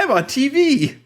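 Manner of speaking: high, gleeful mock squeal; excited background extra. Cohost voicing the smiling girl in the background, high and giddy. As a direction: pitch it way up like a thrilled extra on camera.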